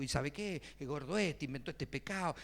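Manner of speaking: ordinary delivery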